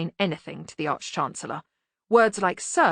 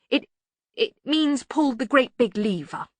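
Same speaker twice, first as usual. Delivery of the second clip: hesitant, slow admission; stall, then admit it slowly; reluctant; 6 semitones higher, 3 dB louder than usual. A narrator's reading. Ponder translating jargon for his superior, reluctantly. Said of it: "Ponder said reluctantly".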